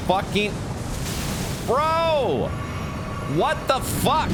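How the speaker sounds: high furious yelling at the boss; angry shout. Real, not acted. Gamer raging at a boss fight, furious shout. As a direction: yell in real frustration at the screen.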